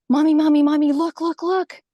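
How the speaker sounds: loud, high repeated calling for mommy; urgent child's call. Retold or imitated. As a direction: call out like a startled little girl tugging at mom. Child calling out to her mother.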